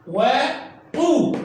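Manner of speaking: وطو stretched very slowly, the oo held long; drawn-out syllable. Shown as a demonstration. A teacher stretches the syllable so children hear it, very slow. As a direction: sound out وطو slowly for the pupils. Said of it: "لاحظ المقطع طو".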